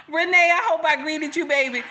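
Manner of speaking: high, loud, laughing greeting. Real, not acted. Greeting Renee mid-laugh, high and laughing. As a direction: greet her through real laughter.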